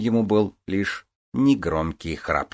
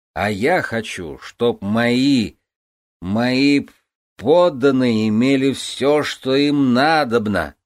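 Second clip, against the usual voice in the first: put-on slurred, slow, loud drunk talk; barely moving his tongue; blackout drunk; 6 semitones higher, 6 dB louder, much slower than usual. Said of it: "еле ворочая языком". (еле ворочая языком)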